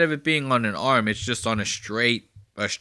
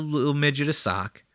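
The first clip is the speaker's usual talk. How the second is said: usual speaking voice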